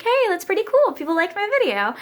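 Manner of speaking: high excited quote; gushing with delight. Retold or imitated. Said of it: "I was like".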